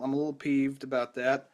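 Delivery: ordinary delivery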